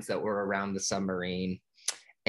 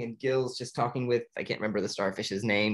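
ordinary delivery

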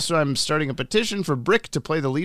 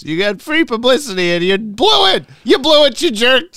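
loud, high teasing scold; mock scolding; 10 semitones higher, 8 dB louder than usual. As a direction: yell it like a playful rebuke.

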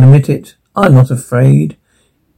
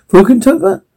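incredulous echo; 9 semitones higher than usual. Acted. Guests repeat the odd place name.

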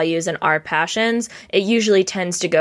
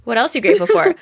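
put-on higher and louder, coaxing a child; aunt coaxing her niece, higher and louder; eager prompting; 9 semitones higher, 4 dB louder, much faster than usual. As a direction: push the question up brightly to the kid. Aunt asks niece repeatedly.

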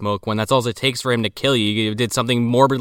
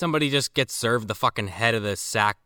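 ordinary talk

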